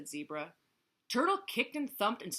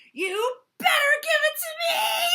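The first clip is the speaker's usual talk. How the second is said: very high screamed threat; screaming; 14 dB louder than usual. A narrator's reading. Turtle threatens in front of Camel, screaming. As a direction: scream it, stretching the demand. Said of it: "screamed Turtle".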